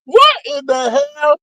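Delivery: high, astonished what; shocked disbelief. Retold or imitated. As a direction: squeal it in disbelief. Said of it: "I was like".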